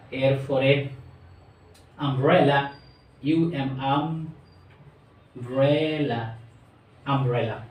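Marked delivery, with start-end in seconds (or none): 3.8-6.4 very slow, each syllable drawn out for the class. Shown as a demonstration. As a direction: stretch the word umbrella slowly into its three syllables. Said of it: "তিনবার উচ্চারণ". (Umbrella ধীরে ধীরে ভেঙে ভেঙে)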